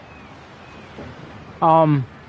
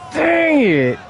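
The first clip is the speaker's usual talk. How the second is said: high, whining "dang it"; frustrated whine; 13 semitones higher than usual. Real, not acted. Ball misses, player frustrated.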